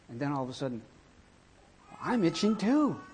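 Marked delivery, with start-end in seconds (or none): 2.0-3.0 high, worried wail; dawning mock alarm. Friend finding he itches too, high mock dismay. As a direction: play-act sudden dismay in a high voice. Acts friend starting to itch.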